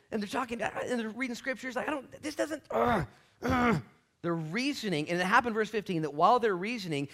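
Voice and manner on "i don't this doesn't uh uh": high, sputtering frustration; acted arguing. Disciples stuck reasoning over scripture, sputtering frustration. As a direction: sputter into wordless exasperated grunts. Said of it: "They're arguing about it".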